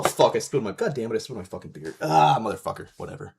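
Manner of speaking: normal talking voice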